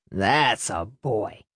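put-on low, slow drunken drawl; slow, deep approving slur; drunken father's voice. A narrator's reading. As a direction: draw it out in a low, boozy voice. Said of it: "his father said".

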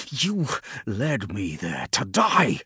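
slow, biting snarl of betrayal. A narrator's reading. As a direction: spit out the accusation, slowly. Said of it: "Callus snarled".

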